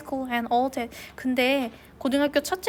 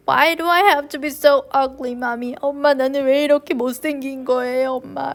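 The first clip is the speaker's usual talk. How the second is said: upset plea; 6 dB louder than usual. A narrator's reading. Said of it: "라고 내가 속삭였어요".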